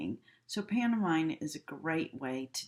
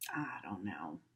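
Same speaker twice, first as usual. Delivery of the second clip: whispered, much lower; shrugging; 8 semitones lower, 9 dB quieter than usual. Shown as a demonstration. Teacher showing a shrug, whispered. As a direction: mumble it with a shrug. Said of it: "a gesture can just be a".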